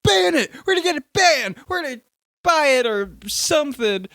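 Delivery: high, loud mock clamour; mock outcry. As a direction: imitate a worked-up crowd demanding action. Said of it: "everyone's like".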